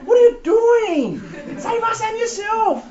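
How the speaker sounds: very high, strained put-on heckler; hammed-up, high-pitched jeer from a dying criminal. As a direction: heckle in a squeaky mock voice, dragging the words. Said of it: "do you hear what he's saying".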